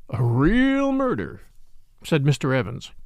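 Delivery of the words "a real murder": slow, higher, relishing gloat. Acted. Mister Evans relishing the murder news, slow gloating. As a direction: savour every word like a treat.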